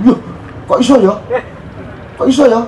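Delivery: put-on high, wondering child's voice; child's amazement. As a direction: marvel like a small boy at a worm. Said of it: "Itu reaksinya".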